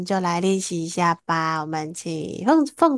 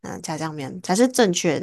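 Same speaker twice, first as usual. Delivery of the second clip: ordinary talk, nothing marked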